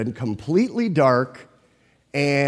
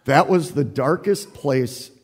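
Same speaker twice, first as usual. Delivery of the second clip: usual speaking voice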